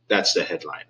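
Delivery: usual speaking voice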